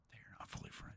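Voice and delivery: conspiratorial whisper; hushed stage whisper. Acted. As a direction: whisper it like a secret. Aside after mocking Quebecers.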